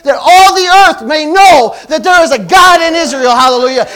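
loud, high, fervent preaching; shouting the sermon to the congregation; full-force preaching. Acted. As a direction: drive it home like a revival preacher.

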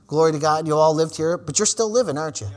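usual speaking voice

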